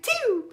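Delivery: small, high squeaky sneeze; normal-season sneeze. Shown as a demonstration. At work in normal season, dainty demo sneeze. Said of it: "in just normal season".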